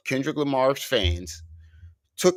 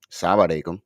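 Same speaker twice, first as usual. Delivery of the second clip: Arabic-accented "Salam alaikum", lower; 4 semitones lower, faster than usual. Shown as a demonstration. Showing friends the Arabic-accented greeting. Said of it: "with an accent".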